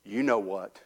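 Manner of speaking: low, knowing bird reply; sly bird. Acted. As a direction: say it low and deadpan, holding back the insult. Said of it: "and he says".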